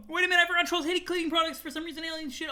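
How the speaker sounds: high-pitched hurried realisation; sudden alarm. Acted. Character remembering trolls' dislikes, high alarm. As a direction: blurt it high, as if just remembering.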